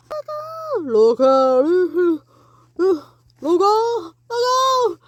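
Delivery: high loud repeated yell; warning shout. Acted. Running into giant.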